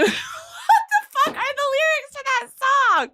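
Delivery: high-pitched laughing squawk; high, incredulous and giggly; exasperated outburst. Real, not acted. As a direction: burst out, baffled by forgotten lyrics. Forgets lyrics mid-rap, breaks off.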